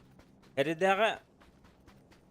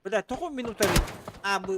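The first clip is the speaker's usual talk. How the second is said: urgent shouting; 7 semitones higher, 5 dB louder than usual. Real, not acted. Panicked call about zone timer.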